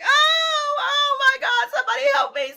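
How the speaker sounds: high, loud terrified shout; terrified cry. Retold or imitated. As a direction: yell for help, caught in the fence. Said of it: "I am scared to death".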